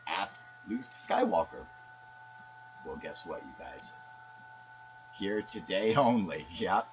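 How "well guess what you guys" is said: dropped to a hush, building suspense; hushed teaser. Acted. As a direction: lean in and hush it like a secret. Host whispers a teaser to fans.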